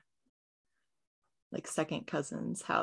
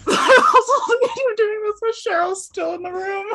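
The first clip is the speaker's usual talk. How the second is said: high, loud, laughing through the words; real laughter swamping the sentence; helpless laughing; 14 semitones higher, 15 dB louder than usual. Real, not acted. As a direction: squeal it out while cracking up. Player cracks up at own scene.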